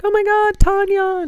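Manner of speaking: high, loud re-enacted squeal of recognition; excited greeting. Greeting artist friend at her booth, excited cry. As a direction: call out her name, high and thrilled.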